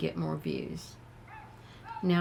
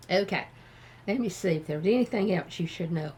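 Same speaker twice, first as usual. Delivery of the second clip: ordinary delivery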